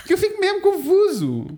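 high, giggling confusion; laughing. Real, not acted. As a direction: laugh it out, bewildered. Laughing at euphemisms.

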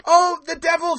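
high, loud sing-song hello; excited greeting. Acted. Greeting the arriving devil, high and loud. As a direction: gush over the newcomer.

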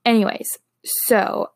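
ordinary talk

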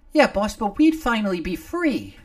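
wistful hope from a tiny sidekick; sighing little Nomar. Acted. Nomar dreaming of freedom, high sighing voice. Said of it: "Nomar sighed".

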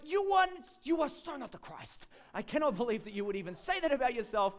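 high, loud, then fast indignation; scandalised scolding. Retold or imitated. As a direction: scold him in disbelief. Brother scolds Jesus.

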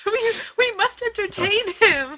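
high, pressing, breaking into laughter; frantic plea. Acted. Galena begs the clerk, high and frantic. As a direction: beg like the world ends. Rolled intimidation, replays plea.

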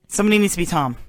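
ordinary delivery

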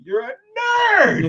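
high, loud, stretched-out taunt; playful schoolyard jeer; mocking shout. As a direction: yell the insult, dragging the last word. Acted mocking taunt at Bartiz.

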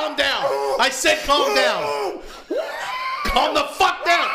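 shouting. Acted. Yelling at squealing friend.

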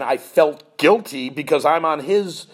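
ordinary delivery

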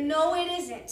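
loud, firm contradiction; emphatic denial. A narrator's reading. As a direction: deny it forcefully. Jack contradicts Lucy's party idea.

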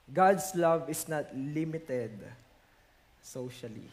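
slow it down and let it sink; slow, solemn repeat. Shown as a demonstration. Preacher repeats point slowly. (mabagal, mahina at mababa sa dulo)

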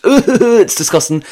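high, slow laughing disgust. Real, not acted. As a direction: laugh it out, grossed out.

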